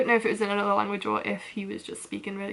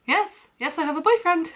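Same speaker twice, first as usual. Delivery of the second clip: flustered high insistence; 6 semitones higher than usual. Retold or imitated. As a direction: push him off politely but urgently. Fending off stranger's question.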